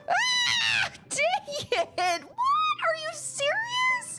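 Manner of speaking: high, cracking cries of disbelief at losing; real outraged yelling. Real, not acted. Streamer yelling at the game after dying, high outraged cries. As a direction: yell out in genuine gaming rage. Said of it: "I'm so mad right now".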